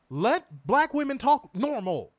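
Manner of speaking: high, loud, slow plea; emphatic, drawn-out exasperation; exasperated demand. Real, not acted. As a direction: spell it out, utterly fed up. Exasperated demand ending the rant.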